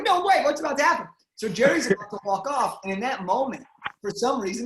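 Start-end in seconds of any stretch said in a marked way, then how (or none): 0.0-1.1 high, louder burst of disbelief; retold disbelief. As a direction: relive the shock, pitched right up. Retold shocked reaction in elevator.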